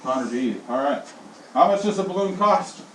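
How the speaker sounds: ordinary talk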